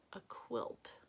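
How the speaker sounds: a quiet whisper while reading; whispered aside. Real, not acted. Host reading a viewer comment on the live, whispered. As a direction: murmur it in a low whisper, half to yourself.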